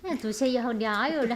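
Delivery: normal talking voice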